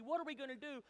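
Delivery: high-pitched panicky question; acted freak-out. Israelites trapped at the Red Sea, mock panic. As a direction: imitate a crowd losing it, voice up. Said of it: "they're freaking out, going crazy".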